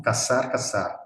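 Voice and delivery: CAZAR, CAZAR both with S; same pronunciation. Shown as a demonstration. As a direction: say CAZAR twice as "ka-SAR", Latin American style. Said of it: "tienen la misma pronunciación".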